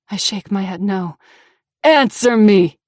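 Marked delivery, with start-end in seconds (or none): 1.8-2.8 loud barked command; furious bellowed order. Acted. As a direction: bellow it at her. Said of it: "he bellows".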